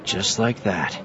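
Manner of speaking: quiet, settled character voice; composed Jedi. Acted. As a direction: answer softly and close it down.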